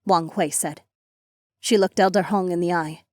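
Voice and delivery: usual speaking voice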